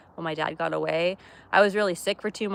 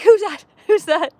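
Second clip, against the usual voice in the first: high, loud puzzled dog voice, much higher and louder; voicing the dog; 10 semitones higher, 9 dB louder than usual. Owner voicing her dog Remy at the door, high loud dog voice. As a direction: yelp it out like a confused pup asking twice. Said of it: "Remy's like".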